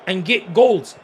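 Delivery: loud, fast hyped-up rant; excited shouting. Real, not acted. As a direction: shout it at the screen, fired up. Hyped about England pressing.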